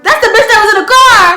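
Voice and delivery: high, loud excited shout; cousin shouting discovery. Retold or imitated. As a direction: shout it fast like you just cracked the case. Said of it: "She's like".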